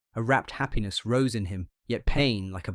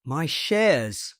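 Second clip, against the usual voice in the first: higher, louder cry; half scream; 5 semitones higher, 5 dB louder than usual. A narrator's reading. Patient cries out about his shares, a half scream. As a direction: cry out in alarm. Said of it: "cried Sandfield with a half scream".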